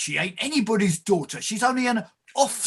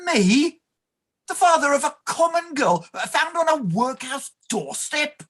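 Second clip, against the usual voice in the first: scornful indignation; 6 semitones higher than usual. Acted. Clerk scornful of common girl.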